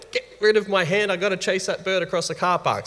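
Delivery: high, loud imitation of an impulsive child; child's voice. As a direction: act out a kid itching to dash off. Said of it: "what do they like doing?".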